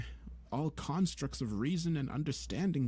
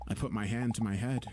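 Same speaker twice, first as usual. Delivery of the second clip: usual speaking voice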